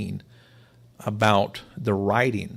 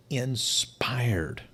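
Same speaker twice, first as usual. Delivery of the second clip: whisper; 3 dB quieter than usual. Acted. Paul to young Timothy, whispered. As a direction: breathe the last word as a whisper. Whispered end of Paul's advice.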